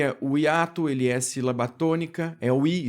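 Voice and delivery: usual speaking voice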